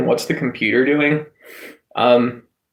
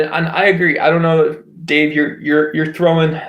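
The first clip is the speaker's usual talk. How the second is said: ordinary talk, nothing marked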